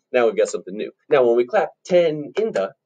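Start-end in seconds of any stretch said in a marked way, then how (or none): 1.8-2.7 TEN held, IN THE quick together; quicker IN THE. Shown as a demonstration. Teacher clapping the rhythm, long TEN and quick IN THE. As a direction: hold TEN, then hurry IN THE. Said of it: "the in the is faster".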